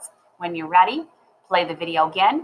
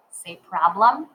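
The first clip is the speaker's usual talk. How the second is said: usual speaking voice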